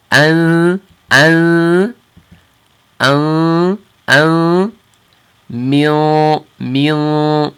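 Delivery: an repeated, the nasal moving back; sliding nasal from front to back; nasal ladder demo. Shown as a demonstration. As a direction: step an back along the mouth, then min. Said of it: "So you hear the difference".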